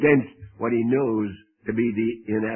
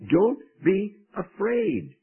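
slow comfort; 7 semitones higher, slower than usual. Real, not acted. Preacher repeats Lord's comfort.

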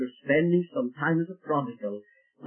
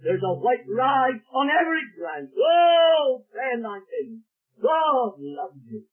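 ringing voice; 6 semitones higher, slower than usual. Acted. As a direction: ring it through the whole car. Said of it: "voice was ringing through the car".

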